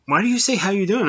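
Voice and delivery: high-pitched imitation of an irked Brit; mock annoyed. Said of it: "they would get angry".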